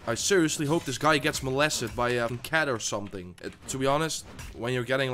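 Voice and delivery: ordinary talk, nothing marked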